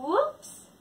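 high surprised whoops; sudden high cry of surprise; mock surprise. Shown as a demonstration. Surprise in rhyme.

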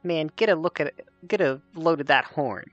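small fairy voice from somewhere; pixie. Acted. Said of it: "a tiny voice somewhere say".

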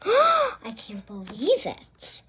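slow breathy whisper after a gasp; gasp, then hush the line in wonder; shocked whisper. Acted. Dramatic gasp in story.

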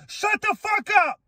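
high, furious outburst at the haters; furious yell. Real, not acted. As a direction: yell it in pure anger. Angry rant at haters.

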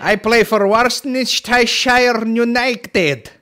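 high, loud, drawn-out garbled sauce name; mock mispronouncing. Joker mangles the sauce name, mock mispronouncing. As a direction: mangle the words loudly in a high comic voice.

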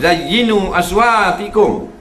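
Quranic recitation. Shown as a demonstration. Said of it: "Hendaklah kamu hias akan suara-suara kamu".

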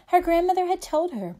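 quick, lifted refrain; brisk refrain. A narrator's reading. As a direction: rattle off the familiar refrain. Repeated refrain read to children.

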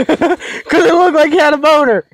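high, amused laughing reply. Real, not acted. Player explains his boner joke to his teammate, laughing. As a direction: say it through laughter, voice pitched high. Joking about a mispronounced word.

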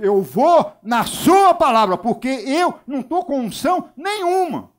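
loud, high, drawn-out protest; exasperated retort. Retold or imitated. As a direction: give in loudly, stretching the key words. Acted exasperated reply to Rosângela.

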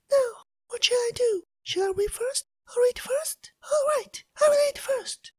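high, soft tearful voice; crying Elsa. Acted. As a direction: whimper it out, close to tears. Elsa dithers alone in field.

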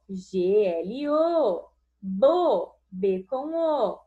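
G L O spelt out slow, letter by letter; slow spelling. Shown as a demonstration. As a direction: spell G L O slowly for children.

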